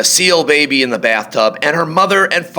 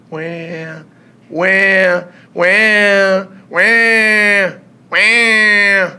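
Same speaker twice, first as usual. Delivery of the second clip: put-on high wailing baby cry; 8 semitones higher, much slower than usual. A narrator's reading. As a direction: wail like a faraway infant. Baby crying far away.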